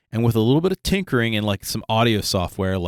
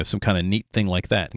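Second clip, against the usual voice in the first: ordinary talk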